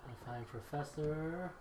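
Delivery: ordinary talk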